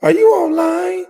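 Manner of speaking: high mock voice of a let-down wife; disappointed wife. Imitating the wife catching him live, disappointed. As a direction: act out the wife's disappointment. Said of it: "She sounded like she was so disappointed".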